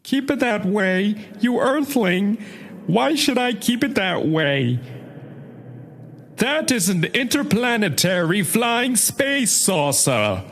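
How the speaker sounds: cartoon Martian villain issuing threats; put-on cartoon alien voice; Marvin the Martian. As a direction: play a haughty little alien invader. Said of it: "my Marvin the Martian impression".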